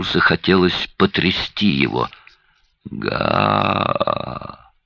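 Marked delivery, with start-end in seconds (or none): 2.9-4.7 deep gurgling croak. A narrator's reading. Dying man's last sound.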